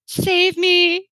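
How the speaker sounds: high, loud mock cry for help; pleading for rescue. Woman in labor to those around her, desperate mock plea. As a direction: plead comically to be saved, voice jumping up. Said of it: "on my face was this expression of".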